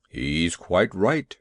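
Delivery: low, nervous big beast; a huge elephant pleading with a tiny dog; timid elephant voice. A narrator's reading. Elephant begging the little dog to leave, low and nervous.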